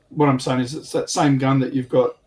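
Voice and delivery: normal talking voice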